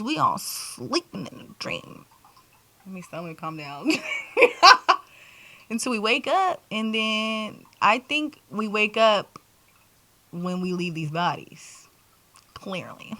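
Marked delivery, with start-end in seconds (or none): 4.0-5.0 loud high burst of laughter. Real, not acted. To her viewers, a loud high laugh. 12.5-13.1 a soft whispered clearly; whispered aside. Real, not acted. To her viewers, a whispered aside.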